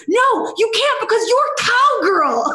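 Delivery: high, loud, drawn-out objection; loud exclamation. Retold or imitated. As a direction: shout it out in disbelief. Director objects, retold loudly.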